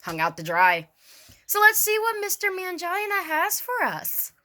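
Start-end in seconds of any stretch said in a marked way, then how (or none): 1.5-4.3 high, mocking sneer. Real, not acted. Vlogger mocking the lawyer's name before reading his letter, sneering. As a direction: say the name with scorn, pitched up high.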